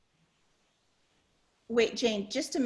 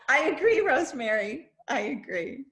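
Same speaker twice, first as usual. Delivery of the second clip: laughing while agreeing; giggly, delighted agreement; 4 semitones higher, 4 dB louder than usual. Real, not acted. Host laughing as she agrees with Rosemary.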